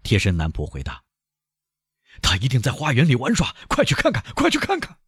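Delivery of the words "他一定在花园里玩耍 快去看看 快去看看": high, anxious urging; panicky, rising to very high pitch; urgent. A narrator's reading. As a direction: send them off searching at once. Frantic father sending servants searching.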